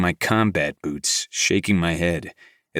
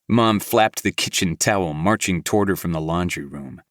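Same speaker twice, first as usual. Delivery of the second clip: plain reading voice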